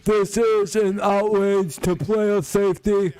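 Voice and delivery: high, slow, prissy complaining voice; mock a crybaby's outrage; hammed-up high whine; mock whiny defender. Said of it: "roughed up by an offensive player".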